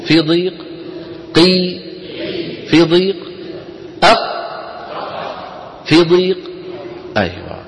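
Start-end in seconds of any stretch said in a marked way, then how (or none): 1.3-1.8 قي with a bouncing qaf; قي with a small echoing qaf; qalqala on qaf. Shown as a demonstration. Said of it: "قولوا مثلي لو سمحتم". 4.0-4.2 أق with an echoing release on the qaf; أق ending in a sprung qaf; qalqala on final qaf. Shown as a demonstration. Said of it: "قولوا مثلي لو سمحتم".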